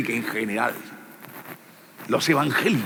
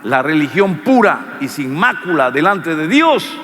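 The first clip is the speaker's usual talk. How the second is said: ordinary talk, nothing marked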